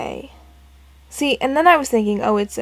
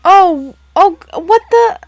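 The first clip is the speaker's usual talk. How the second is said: loud, high startled outburst; 8 semitones higher, 11 dB louder, slower than usual. Real, not acted. Player reacting to the final hint, startled. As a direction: burst out in shocked confusion.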